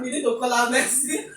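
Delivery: ordinary talk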